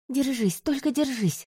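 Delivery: high, desperate pleading. A narrator's reading. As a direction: beg him to hold on. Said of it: "молила я".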